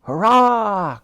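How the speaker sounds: high loud cheer. A narrator's reading. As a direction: celebrate the rescue. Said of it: "cried all on board".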